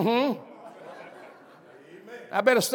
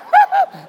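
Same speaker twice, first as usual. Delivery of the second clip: high burst of laughter; 5 dB louder than usual. Real, not acted. Preacher laughs at own story.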